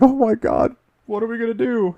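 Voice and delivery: high, squealing mock panic. A survivor wailing at the vault staff, mock panicked and high. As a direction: play a frightened newcomer, overdone.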